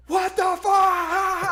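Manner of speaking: loud, high, slow shocked scream. Retold or imitated. Seeing her hair fall off in the sand: slow, loud, high shocked scream. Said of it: "I'm screaming".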